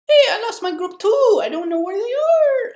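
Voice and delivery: very high, loud falsetto; hammed-up shrieking girl voice. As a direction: imitate a shouty party girl. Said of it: "this girl who was loud".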